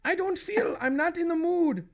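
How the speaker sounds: high acted wife voice; reluctant wife. Wife turns away husband's advance, high and reluctant.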